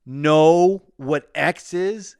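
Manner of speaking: high, loud, slow incredulous sneer; mocking the director. Imitating the director scoffing at the crew, slow and sneering. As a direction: stretch it out in disbelief like a film snob. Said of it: "and he'd be like".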